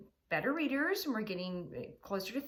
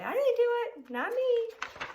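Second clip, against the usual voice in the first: high, loud fake-innocent protest; sneaky pug denying; 7 dB louder than usual. Pig denies everything in a high, loud squeal.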